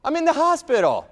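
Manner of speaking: squeaky high outburst of disbelief; shocked disbelief. Retold or imitated. Injured man to wife serving papers, shocked high squeak. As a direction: voice jumps way up, stunned. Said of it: "I'm like".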